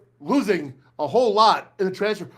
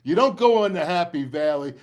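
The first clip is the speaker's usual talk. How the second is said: normal talking voice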